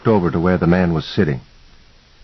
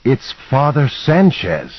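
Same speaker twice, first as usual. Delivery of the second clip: pitched up, drawn-out with excitement; slow, high-pitched thrill of recognition; contained excitement; 7 semitones higher, slower than usual. A narrator's reading. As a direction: announce it, barely holding in your excitement. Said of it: "barely able to contain my excitement".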